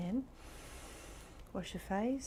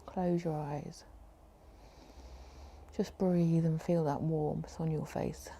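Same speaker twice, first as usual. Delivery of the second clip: guided meditation. Acted. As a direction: ease them into calm. Leads a relaxation meditation.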